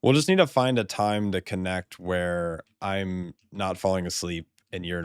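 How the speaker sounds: usual speaking voice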